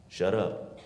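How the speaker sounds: normal talking voice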